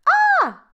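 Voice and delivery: high, loud shriek of fright; frightened scream. A narrator's reading. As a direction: scream in sudden terror. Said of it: "was very frightened".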